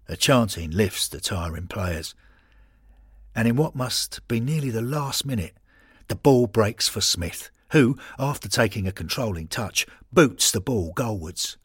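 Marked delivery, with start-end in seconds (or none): none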